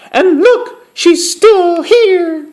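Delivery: loud, very high cub squeal, slowing at the end; crying-out Baby Bear. A narrator's reading. Baby Bear discovers Goldilocks in his bed, loud high cry. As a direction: squeal it as loudly as a tiny bear can. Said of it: "cried Baby Bear as loudly as he could".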